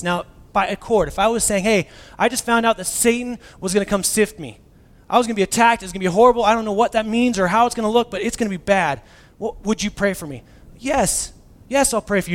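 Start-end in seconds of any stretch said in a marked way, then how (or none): 1.6-10.4 higher, louder anxious plea for prayer; hammed-up worried friend asking for prayer; panicked plea. 10.8-12.3 eager promise. Retold or imitated. Eager reply to friend.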